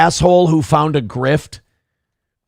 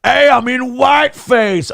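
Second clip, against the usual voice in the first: higher, slow, hammed-up announcement; mocking Drewski; 8 semitones higher than usual. Comic mocking a whiteface bit, higher and slow. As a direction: spell out the obvious joke, higher and slow.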